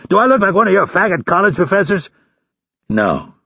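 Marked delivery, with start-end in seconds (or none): 0.1-2.1 fast, high-pitched angry bark. A narrator's reading. Instructor berates recruit for answering wrongly, barked. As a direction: tear into him like a drill instructor. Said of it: "Hurley barked".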